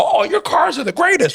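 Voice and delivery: high, gushing suck-up voice; fawning praise. Retold or imitated. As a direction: gush over it like a flatterer. Mocking Trump praising Tesla.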